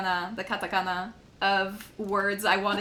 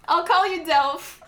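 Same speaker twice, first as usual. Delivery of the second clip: laughing; 6 semitones higher, 4 dB louder than usual. Real, not acted. Laughing at own mispronunciation.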